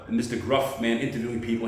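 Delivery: usual speaking voice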